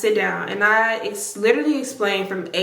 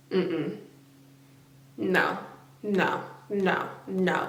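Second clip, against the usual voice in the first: quiet refusal; 2 semitones lower, 8 dB quieter, much slower than usual. Retold or imitated. Refusing to argue.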